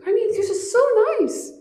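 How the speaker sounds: put-on high-pitched old lady's voice, curious; old woman asking. Old woman asks the kind stranger his name, high.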